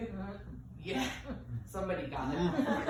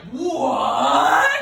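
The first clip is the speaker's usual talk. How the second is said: mock shock; 6 semitones higher, 13 dB louder than usual. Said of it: "No one was like".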